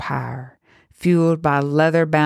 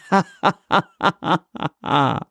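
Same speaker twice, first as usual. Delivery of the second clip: witch's laugh; 2 semitones higher than usual. A narrator's reading. Witch laughing at the priest as she passes the pew, mocking laugh. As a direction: laugh at him, taunting. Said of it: "She laughed".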